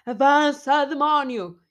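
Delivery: hammed-up yelling agent; mild mock shouting staff. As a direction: bark them forward like a rude clerk. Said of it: "a gritar para as pessoas".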